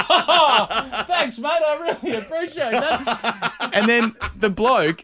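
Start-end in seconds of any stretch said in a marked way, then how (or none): 0.1-3.7 high, laughing, slow sarcastic thanks; mock sarcastic thanks. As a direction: overdo the fake gratitude, laughing. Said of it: "And I was like".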